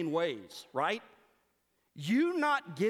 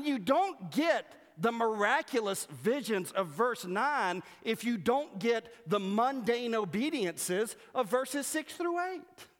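ordinary talk

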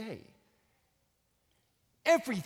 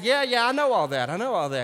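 brushing off; 6 semitones higher, 6 dB louder than usual. Retold or imitated. Acted dismissive cab driver.

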